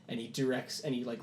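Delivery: usual speaking voice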